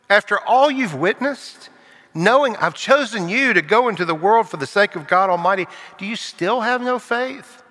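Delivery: Jesus teasing his frightened disciples; gentle rebuke. Acted. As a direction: chide them with amused disbelief.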